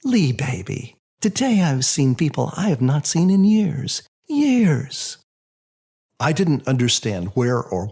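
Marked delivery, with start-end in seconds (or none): 0.0-5.2 higher, wondering mother's voice, soaring on the last Years; a hint of an amazed old mother; lightly voiced mother. Mother telling her son who she saw today, lightly voiced and wondering. Mom tells son about heaven.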